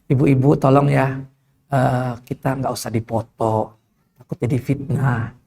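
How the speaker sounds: low, softening request; pleasant, polite announcement. Shown as a demonstration. Woman announcing the no-photo request beforehand, gentle and low. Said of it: "Kan enak ngomongnya begitu".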